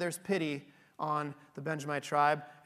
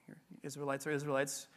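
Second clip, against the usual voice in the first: whispered welcome; 4 semitones lower, 9 dB quieter, faster than usual. Retold or imitated. Host inside his welcome.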